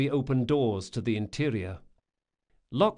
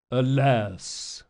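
theatrical cry of lament; big-voiced priest; 3 dB louder than usual. A narrator's reading. Priest lamenting orphans to the passing crowd, big-voiced. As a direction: cry out to the passers-by like an orator. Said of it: "the big-voiced man yell at him".

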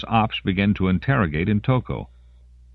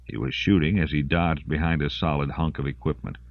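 ordinary delivery

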